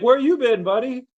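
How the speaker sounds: high, bright where you been buddy; buddy greeting. Retold or imitated. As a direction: greet a friend back home. Roommate Mike greets him.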